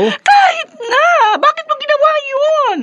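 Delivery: a mother's high, dragging rebuke; exasperated scolding. Acted. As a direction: hurl the disbelief at your son. Mother scolds son over stabbing.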